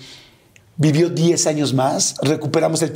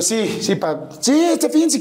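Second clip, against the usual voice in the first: high, drawn-out eager yes, slowed down; 7 semitones higher, slower than usual. Retold or imitated. Son eagerly telling his father they can go this weekend, high and slow. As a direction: stretch the yes out, pleading and hopeful.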